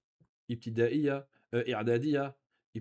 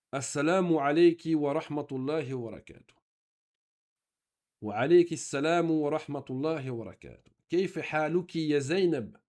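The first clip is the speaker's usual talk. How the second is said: a touch louder, careful textbook reading; dialogue reading; 3 semitones higher, 4 dB louder than usual. Acted. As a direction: read the dialogue lines out for the class. Reads textbook dialogue aloud.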